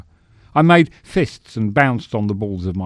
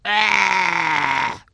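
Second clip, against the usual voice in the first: long, high, falling scream; drawn-out victim's cry; stage death scream; 8 semitones higher than usual. Acted.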